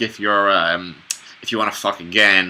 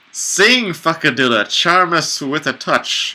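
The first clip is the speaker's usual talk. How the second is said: high character voice; 7 semitones higher, slower than usual. Acted. Character line in a play.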